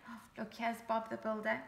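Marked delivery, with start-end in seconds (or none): none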